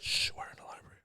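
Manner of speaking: hushed shushing whisper; librarian shushing whisper. Pretend. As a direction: shush them like a strict librarian. Pretend library shushing bit.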